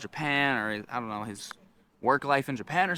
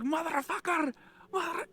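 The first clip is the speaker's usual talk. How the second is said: high, strained mock scream; retold high-pitched rant; imitated screaming. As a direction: curse like the screaming stranger. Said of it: "he's screaming around".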